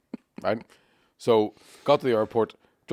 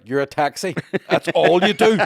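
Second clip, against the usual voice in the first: incredulous protest, rising higher and louder; indignant outburst; 5 semitones higher, 5 dB louder, slower than usual. Retold or imitated. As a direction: argue back, outraged. Acted argument with taxi driver.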